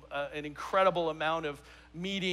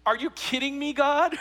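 higher, louder disbelief thrown at God; incredulous outburst; 7 semitones higher, 5 dB louder than usual. Acted. Pastor voicing his shock at the verse, loud and high. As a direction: protest as if the verse is absurd.

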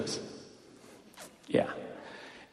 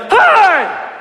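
sudden commanding shout, much higher and louder; Sergeant Bush; 7 dB louder than usual. Retold or imitated. Coach dad walks in on squabbling team, commanding shout. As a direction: cut through the bickering with one big holler. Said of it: "my dad had that voice".

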